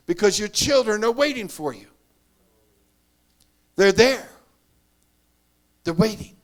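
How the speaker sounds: loud, impassioned pulpit appeal to grieving mothers; full-force preaching. Acted.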